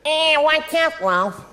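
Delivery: high squeaky cartoon rabbit voice, loud; Bugs Bunny. Comic doing a deliberately off cartoon impression, high and loud. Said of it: "Here's my Bugs Bunny".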